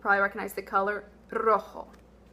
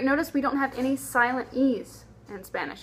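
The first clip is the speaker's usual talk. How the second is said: ordinary delivery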